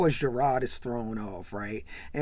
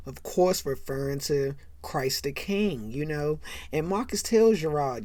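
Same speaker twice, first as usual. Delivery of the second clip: ordinary delivery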